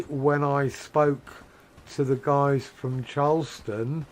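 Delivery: slow way down and break up every word; slow, broken-up speech. Shown as a demonstration. For cruise guests from Charleston, slow deliberate speech. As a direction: speak slowly and clearly, one word at a time. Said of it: "I had to speak like this".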